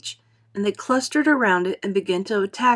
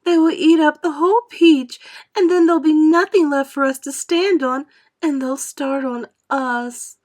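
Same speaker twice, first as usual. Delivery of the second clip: much higher, louder frightened wail; panicky despair; 8 semitones higher, 7 dB louder than usual. A narrator's reading. Miss Spider fearing the sharks will eat them. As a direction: pour out the panic.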